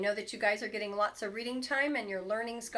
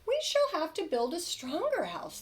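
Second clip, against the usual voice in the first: high little wolf voices; little wolves; 9 semitones higher than usual. Acted. Wolf cubs decide to build stronger, high. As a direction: resolve it like brave small cubs. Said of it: "they said".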